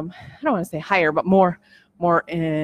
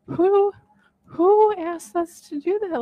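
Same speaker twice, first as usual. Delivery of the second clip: much higher, slow, delighted disbelief; astonished; 10 semitones higher, slower than usual. Real, not acted. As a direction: ask it as if you can't believe it. Amazed by the workbook prompt.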